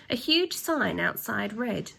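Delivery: ordinary delivery, nothing marked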